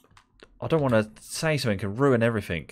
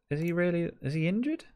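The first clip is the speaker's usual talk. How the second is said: incredulous; 13 semitones higher than usual. Real, not acted. Player injury moment.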